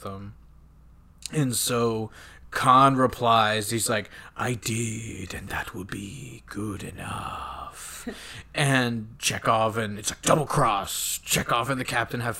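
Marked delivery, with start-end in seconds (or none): 4.4-8.1 hushed, half-whispered villain; slow, soft and menacing, fading away; sinister villain reply. Retold or imitated. Khan replying over the wrist communicator, quietly sinister.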